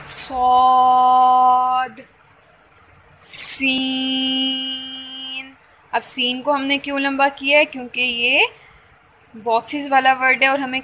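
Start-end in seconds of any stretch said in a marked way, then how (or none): 0.1-5.6 सौद held for three alifs, slow and loud; सौद sung out very long and loud; elongated letter. Shown as a demonstration. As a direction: stretch सौद out long like a Tajweed drill. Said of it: "put finger on सौद".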